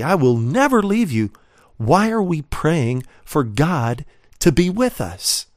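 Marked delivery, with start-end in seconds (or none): 0.0-1.3 "never" punched higher in pitch; very emphatic. Acted. Preacher quoting God's promise to the congregation, very emphatic. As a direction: lean hard on "never" as a firm promise. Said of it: "God is saying very emphatically".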